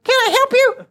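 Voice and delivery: tiny high-pitched squeak; squeaky high voice. Retold or imitated. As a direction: say it in a timid falsetto. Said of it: "to most people, sounds like".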